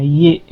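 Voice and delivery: ये said clearly as ye with a y glide; teacher sounding out ये; Tamil ye sound. Shown as a demonstration. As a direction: model ये crisply for pupils. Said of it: "लेकिन वो पढ़ते इसको ये हैं".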